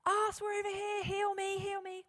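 high pleading cry of the blind men; high-pitched plea for healing; blind men calling out. Acted. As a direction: call out desperately to be healed. Blind men shouting to be healed.

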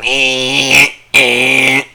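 drawn-out buzzer noise; alert sound imitation. Podcaster mimics the alert he ignored, long buzzing noise. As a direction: imitate a phone alarm, held buzz. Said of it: "the fucking sound".

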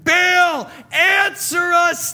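high, loud cry, drawn out; desperate shout. Acted. Prophets crying out to Baal, a desperate high shout. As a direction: shout to a god who won't answer. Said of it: "they shouted".

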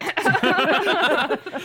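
loud burst of laughter. Real, not acted. Big laugh at the story.

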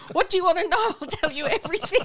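high, squeaky panicked captive blurting it all out; a hostage who caves at once, squealing in a high voice; mock terrified captive. Hostage begging interrogators in a squeaky panicked voice.

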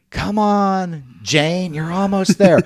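high cheering call; cheering crowd. Retold or imitated. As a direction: shout encouragement from the sidelines. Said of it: "I hear these people saying".

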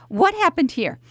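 normal talking voice

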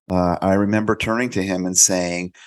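normal talking voice

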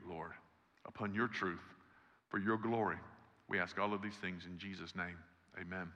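low, subdued pastoral prayer; leading prayer. Real, not acted. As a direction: pray aloud for the congregation, softening toward the amen. Leads the congregation in prayer.

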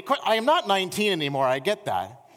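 ordinary delivery

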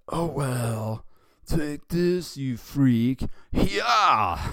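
high cartoon bully attacking; loud, high taunt building to a battle cry; Nelson. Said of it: "And then Nelson said".

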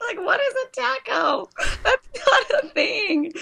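very high, squeaky through laughter; high voice breaking into laughter, slowing at the end; cracking up. Real, not acted. Host cracking up over taco misunderstanding.

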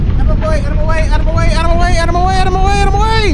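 loud high repeated shouting; frantic shout. Acted. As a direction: push through the crowd yelling. Rushing in to fight fire.

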